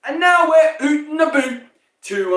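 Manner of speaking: high, loud mock Canadian newscaster voice. George Carlin mocking a Canadian newscaster, loud high Canadian accent. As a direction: ham up a Canadian anchor saying ootin' a boot. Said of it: "newscasters from Canada who just go".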